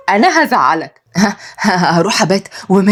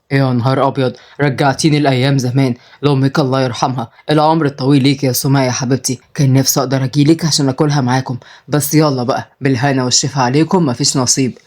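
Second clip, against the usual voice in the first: put-on old father voice; 5 semitones lower than usual. Old father talks to his daughter on the phone, low voice. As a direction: speak like a wistful grandfather.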